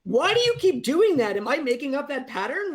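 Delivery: high, loud bewildered outcry; baffled outburst. Retold or imitated. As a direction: sound freaked out and confused. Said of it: "the insane feeling of being like".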